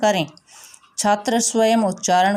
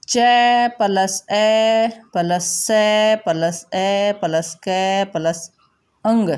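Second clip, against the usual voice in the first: slow spelling out; slower than usual. Shown as a demonstration. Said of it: "तो इसको मिलकर देखो क्या बन गया".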